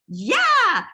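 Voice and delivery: high, loud, thrilled yeah; excited yeah. Retold or imitated. Sponsor thrilled by Jen's news, a loud high excited yeah. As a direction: burst out with delight at the news. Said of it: "She was all excited".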